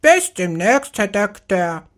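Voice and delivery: high squawking bird farewell; croaking parrot. Acted. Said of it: "krächzen".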